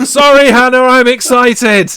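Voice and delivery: loud, high-pitched re-enacted yell; excited shouting. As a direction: holler it back, drawn out with excitement. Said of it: "Stop shouting".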